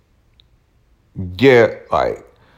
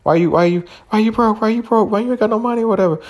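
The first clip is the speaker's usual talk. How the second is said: much higher mocking voice of a fed-up woman; nagging girlfriend; 11 semitones higher, faster than usual. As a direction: play the girlfriend demanding why he is broke. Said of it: "What if I was a woman".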